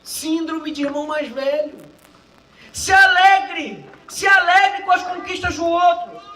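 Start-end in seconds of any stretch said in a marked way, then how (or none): 2.7-6.2 loud, slow stretched exhortation; preaching shout. Real, not acted. Preacher urging the congregation to rejoice, loud slow shout.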